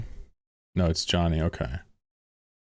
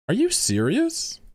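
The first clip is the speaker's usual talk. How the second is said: slow, much higher incredulous question; disbelief; 14 semitones higher, slower than usual. Real, not acted. Streamer realising he fell for a fake post, incredulous. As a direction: stretch it out, floored.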